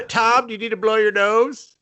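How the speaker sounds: high teasing question; mock sweet wife. Wife teasing Tom about his tissues, high and mocking. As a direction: coo it at him sarcastically. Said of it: "voicing wife to Tom".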